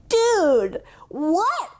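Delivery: hammed-up stunned friend; amazed disbelief. Retold amazed reaction between friends.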